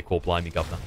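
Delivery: low, understated British catchphrase; British accent. Shown as a demonstration. Explaining how people fake a British accent, understated. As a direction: toss off the British cliché quietly. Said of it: "that's how you do a British accent".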